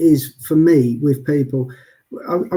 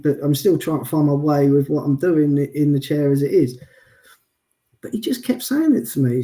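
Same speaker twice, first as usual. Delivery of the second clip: ordinary talk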